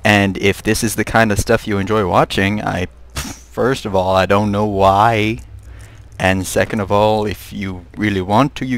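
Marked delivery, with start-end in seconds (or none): none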